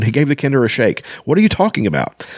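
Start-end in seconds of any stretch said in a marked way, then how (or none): 1.2-2.2 higher-pitched, insistent question; firm, impatient questioning; stern. A narrator's reading. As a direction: demand an explanation. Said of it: "his voice stern".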